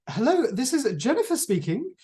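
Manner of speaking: high, swooping singsong telephone hello; phone voice. Retold or imitated. Mother answering the home telephone, in a put-on phone voice. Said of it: "a phone voice".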